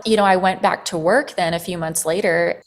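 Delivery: ordinary talk, nothing marked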